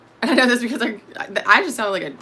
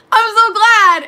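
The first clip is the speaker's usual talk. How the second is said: gushing reply; 11 semitones higher, 7 dB louder, slower than usual. Retold or imitated. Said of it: "I'd always be like".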